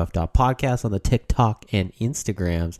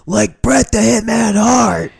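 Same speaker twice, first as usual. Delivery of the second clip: excited announcer; 14 semitones higher, 8 dB louder, slower than usual. Acted. Excited wrestling announcer comparison.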